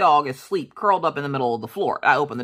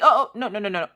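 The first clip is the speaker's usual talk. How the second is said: stopping the cop at the door, mildly raised; sharp retold refusal; 7 semitones higher, faster than usual. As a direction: cut her off, pitched up.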